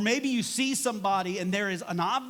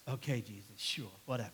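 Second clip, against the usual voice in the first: low, soft eye-rolling compliance; mock teenage reluctance; begrudging teenager; 8 semitones lower, 7 dB quieter than usual. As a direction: give in like a sulky teen. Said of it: "my inner teenager rolls its eyes".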